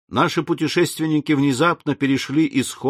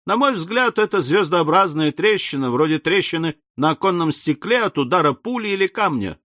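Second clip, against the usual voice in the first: higher-pitched, lively explanation; breezy Ardan, confident; 6 semitones higher than usual. Acted. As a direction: offer your theory with easy confidence. Voices confident Ardan in dialogue.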